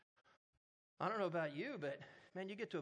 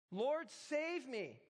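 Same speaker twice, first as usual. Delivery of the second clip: higher, very slow anguished cry; the second plea, pitched up in panic; 8 semitones higher, 3 dB louder, much slower than usual. A narrator's reading. Peter going under cries again to the Lord, higher anguished cry. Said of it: "cried out".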